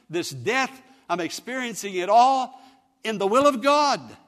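loud, high, building cry of anguish; full-force preaching. Acted. Preacher voicing Jesus in the garden, impassioned preaching. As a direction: pile up each trouble with rising force.

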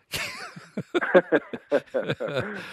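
real high, rippling laugh; hearty laugh. Real, not acted. Radio host joking about a crowd of guests, hearty laugh. Host amused after his own remark.

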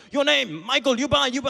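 high, loud mock call of a street seller; yelling street hawkers. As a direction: imitate pushy hawkers shouting a name. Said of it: "People were yelling at me, going".

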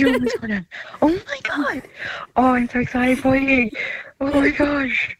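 high, bubbling delight; overjoyed. Real, not acted. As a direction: gush with excitement. Sister overjoyed on the phone.

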